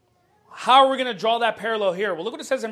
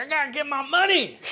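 higher-pitched mock frustration; imitated frustration; 4 semitones higher than usual. Preacher voicing Balaam wanting his money, in mock frustration. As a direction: play him worked up and frustrated. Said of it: "He's all frustrated".

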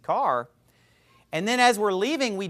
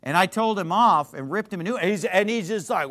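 ordinary talk, nothing marked

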